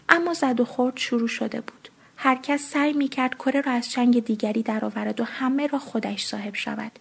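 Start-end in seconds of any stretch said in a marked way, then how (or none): none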